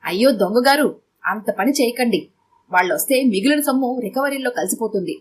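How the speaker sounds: ordinary delivery, nothing marked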